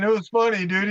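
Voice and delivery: usual speaking voice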